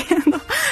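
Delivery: bright high burst of laughter; quick rising giggle; real laugh. Real, not acted. Host laughs at mother's story.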